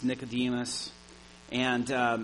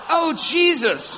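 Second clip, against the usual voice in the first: alarmed cry; 10 dB louder, slower than usual. Retold or imitated. Panicked realizing mailbox is far.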